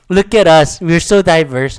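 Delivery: loud, hammed-up showing off; mock self-congratulation. Mocking a Hollywood diversity moment, sarcastic self-congratulation. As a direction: proclaim it as a sarcastic boast.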